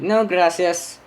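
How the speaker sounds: mock-polite high refusal; retold refusal. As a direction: turn it down in a high voice.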